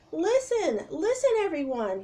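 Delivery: high loud repeated call; yelling. Acted. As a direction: break through the roar. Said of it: "he yelled".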